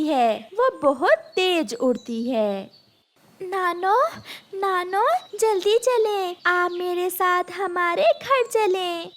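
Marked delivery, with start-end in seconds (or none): 3.4-9.1 put-on high, urgent childlike call; a small girl calling her grandma; little girl calling. A narrator's reading. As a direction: hurry grandma along.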